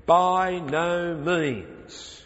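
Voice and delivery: a preacher thundering the apostle's verdict, each word slow and firm; emphatic denial. Retold or imitated. Preacher voicing Paul's emphatic answer to the objector, slow and loud. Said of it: "which Paul answers emphatically".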